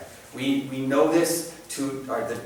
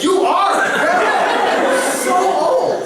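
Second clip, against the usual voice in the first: higher, louder teasing through laughter; laughing tease; 8 dB louder, much slower than usual. Retold or imitated. Great-grandson teases his old great-grandma, laughing.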